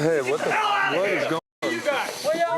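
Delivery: high, drawn-out alarmed question. Real, not acted. Host stumbles into a sudden commotion, alarmed. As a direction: demand to know what is happening.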